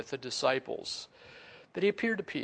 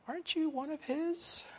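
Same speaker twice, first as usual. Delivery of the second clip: put-on high, softer little-girl voice; 13 semitones higher, 5 dB quieter than usual. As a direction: put on a small girl's questioning voice. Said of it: "it only took a little girl".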